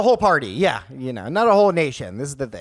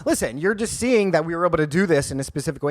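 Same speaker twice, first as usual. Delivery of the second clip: ordinary talk, nothing marked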